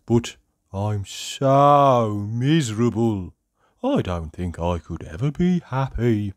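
slow, hopeless cartoon moan; miserably. A narrator's reading. Sad character doubts he can be happy, miserable and drawn-out. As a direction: drag it out in squeaky misery. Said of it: "he added miserably".

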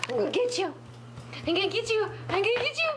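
put-on high singsong chase voice, teasing the baby; playful baby talk. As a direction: sing it up high as you chase a toddler.

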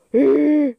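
sharp loud gasp. Real, not acted. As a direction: gasp in sudden surprise. Finds something in game.

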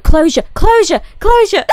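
high, loud burst of relief; triumphant. Real, not acted. Finally finding the word she forgot, triumphant. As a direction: shout the word as it finally comes back.

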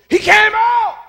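high, full-force shout; sudden piercing pulpit yell; loud cry. Acted. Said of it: "cried out with a loud voice".

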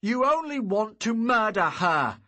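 loud, high furious cry; furious accusation. Acted. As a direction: accuse him outright. Hero accuses father.

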